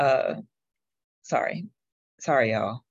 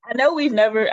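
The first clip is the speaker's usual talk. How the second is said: usual speaking voice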